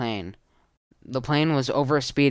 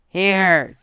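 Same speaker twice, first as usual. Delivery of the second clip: loud, higher shout over noise; 7 semitones higher, 8 dB louder than usual. Acted. Tudor tossing a gun over the engines, shout. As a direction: yell it over the roaring engines. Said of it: "Tudor shouted over the engines".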